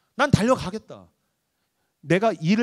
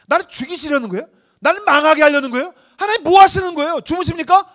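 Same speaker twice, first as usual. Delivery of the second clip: loud, higher cry of protest at God; David's angry outcry; 6 semitones higher, 7 dB louder than usual. Acted. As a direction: shout the accusation up at heaven. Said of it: "분노하고 있는, 절규하고 있는 다윗". (하나님께 분노하며 절규하는 다윗)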